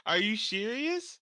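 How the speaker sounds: high, loud, laughing astonishment; incredulous questions rising loud and high; real disbelief. Real, not acted. Friend is told an actor does comedy specials, astonished. Fooled by a false claim.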